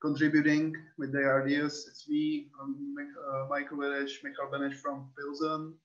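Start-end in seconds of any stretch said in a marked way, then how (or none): none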